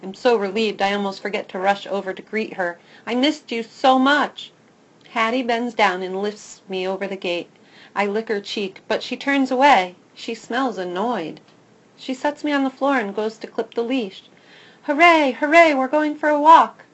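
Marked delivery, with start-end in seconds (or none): 3.1-4.5 excited greeting. A narrator's reading. Dog greets returning girl. 14.8-16.8 much louder, higher cheer; thrilled cheering. A narrator's reading. Puppy thrilled to go for a walk, loud cheer.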